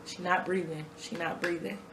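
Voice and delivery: low-energy, soft retelling of panic; subdued replay. Retold or imitated. Fiancé reporting the child not breathing, replayed hushed and subdued. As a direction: repeat his frantic words softly, sinking away. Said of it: "this is how I'm replaying it".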